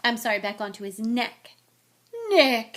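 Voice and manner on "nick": loud, higher hearty roar. A narrator's reading. Headless huntsman hailing Nick, roaring. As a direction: boom the name out. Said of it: "he roared".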